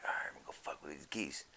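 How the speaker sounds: sly whispered scheme about the geese; scheming whisper. Retold or imitated. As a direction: hatch the plan in a sly whisper. Scheming plan retold.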